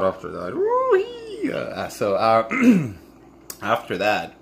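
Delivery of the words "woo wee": a high squealing whoop; woo-wee whoop. Real, not acted. Bracing herself before the next story, high whoop. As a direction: let out a sudden high whoop. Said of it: "Oh, God".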